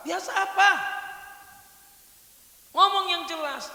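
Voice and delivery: high, loud demanding bark; angry father snapping at daughter; stern father. Acted. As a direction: press her hard for an answer. Strict father demands an answer.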